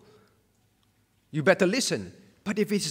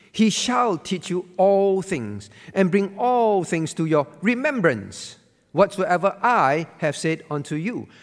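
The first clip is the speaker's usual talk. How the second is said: slow, louder Bible reading with weight; solemn scripture reading; 5 dB louder than usual. Acted. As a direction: read the verse out like a proclamation, slowly.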